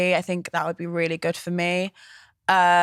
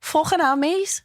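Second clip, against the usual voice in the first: higher-pitched, playful Scouse voice; Scouse accent; 7 semitones higher than usual. Shown as a demonstration. Friend showing off the sneaky Scouse accent, hammed-up Scouse. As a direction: put on a cheeky Scouse accent. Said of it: "did a sneaky Scouse accent".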